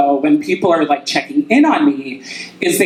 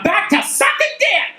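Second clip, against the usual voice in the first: triumphant shout. Real, not acted. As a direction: yell it out proudly. Punchline shouted to crowd.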